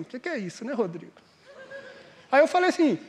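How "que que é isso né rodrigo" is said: much softer, lower reproachful murmur; wife's reproach. Retold or imitated. Wife quietly scolding husband at the restaurant, soft reproach. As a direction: hush it like a wife nudging her husband. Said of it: "olhou pra mim, do tipo assim".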